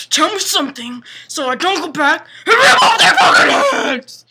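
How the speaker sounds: building to a high, roaring yell; mock enraged shout. Edward tells the girl to stop him going back, mock rage building to a roar. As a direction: act out rage that explodes at the end.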